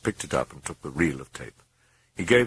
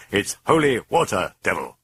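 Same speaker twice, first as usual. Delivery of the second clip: forceful priest; 3 semitones higher, 5 dB louder than usual. A narrator's reading. Priest confronts the demon.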